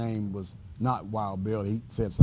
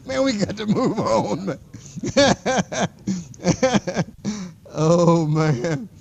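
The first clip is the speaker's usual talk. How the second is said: much higher and louder, talking through laughter; laughing; 9 semitones higher, 6 dB louder than usual. Real, not acted. Teacher laughs while telling class to move on, laughing. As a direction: try to talk while cracking up.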